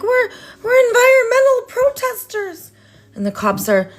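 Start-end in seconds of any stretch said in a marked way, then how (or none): 0.0-2.7 high, wailing mock cry; imitating crying protester. Retelling the protester pleading with police, mock crying. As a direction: whimper it like a panicked protester. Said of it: "screaming and crying".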